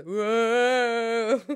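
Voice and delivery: drawn-out mock "whoa"; long swooping imitation of a woo; imitated "woo". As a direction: mimic the startled woo from the recording. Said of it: "I think I liked yours better".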